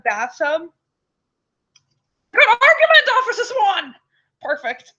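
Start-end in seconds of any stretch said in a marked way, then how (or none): 2.3-3.9 high, mock-posh judge voice; put-on accent. Judge praising Officer Swan, in a silly high accent. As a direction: play a pompous judge in a funny accent. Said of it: "Perfect accent".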